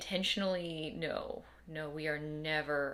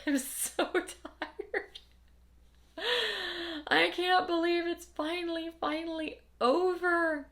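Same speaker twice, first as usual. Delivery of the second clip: laughing exhaustion; 13 semitones higher, 6 dB louder, slower than usual. Retold or imitated. Reliving exhausted relief in labor.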